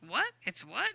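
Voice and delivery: mock-confused student asking what; quick, quiet double take with a sharp questioning lift; puzzled what. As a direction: act out a baffled reaction. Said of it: "gonna be a little like".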